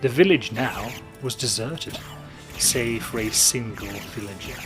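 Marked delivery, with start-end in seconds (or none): none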